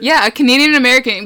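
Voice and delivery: ordinary talk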